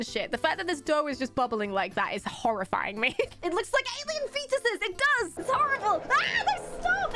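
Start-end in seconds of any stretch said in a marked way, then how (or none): 6.1-7.1 high squealing no, stop; squeal of disgust. Real, not acted. Grossed out by bubbling alien-looking dough, squealing high.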